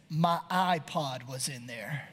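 low, slow, deadpan; dead serious. Retold or imitated. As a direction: say it gravely, as if it matters. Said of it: "looks down at me in all seriousness".

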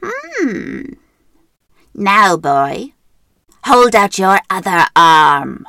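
loud, slow suspicious witch. Acted. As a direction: growl the order like a witch. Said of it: "and then said".

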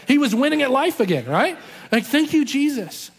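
joyful praise. Real, not acted. Preacher celebrating his dad's happy ending, joyful and loud. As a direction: lift it up like a shout of praise.

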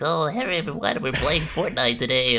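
high nerdy voice; nerdy streamer greeting; nerd voice. Acted. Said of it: "my nerd voice".

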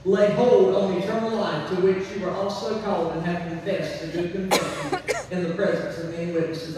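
ordinary delivery

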